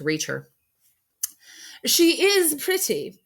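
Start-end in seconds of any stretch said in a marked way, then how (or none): 1.8-3.2 very high, slow, prim lady; Missus Liddell. Acted. Missus Liddell appraising the orphan, high and slow. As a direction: drawl it out like a fussy society lady.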